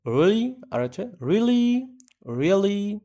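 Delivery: "Really" high and very slow, stretched out. Shown as a demonstration. Teacher shows students a high drawn-out "Really". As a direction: draw "Really" up high and long. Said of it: "ঠিক আছে".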